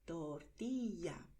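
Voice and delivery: tortilla said slowly with ll as ly. Shown as a demonstration. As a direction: draw out tortilla, torti-lya. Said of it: "puedes pronunciar".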